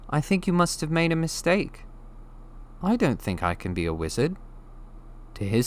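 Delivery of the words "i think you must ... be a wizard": put-on young Harry, doubtful; unsure, gently protesting; hesitant boy. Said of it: "he said quietly".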